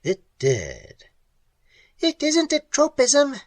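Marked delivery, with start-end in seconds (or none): 2.0-3.4 much higher, shrill creature voice; shrill clicking. Acted. Creature correcting Pat, shrill clicking voice. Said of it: "it clicked in shrill".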